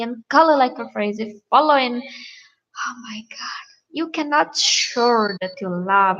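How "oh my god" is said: whispered, slow oh my God; exasperated whisper. Real, not acted. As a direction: breathe it out in disbelief. Exasperated aside.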